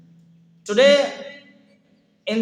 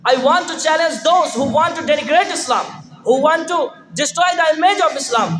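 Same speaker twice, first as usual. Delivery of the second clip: raised, declaiming challenge; shouting. Acted. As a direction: call it out to the hall. Challenge climax of competition speech.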